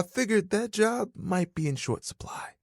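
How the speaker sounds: hushed unvoiced whisper; whispered musing to himself. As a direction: breathe it like a private thought. Towel muses aside.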